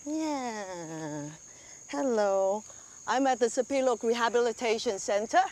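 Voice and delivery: ordinary talk, nothing marked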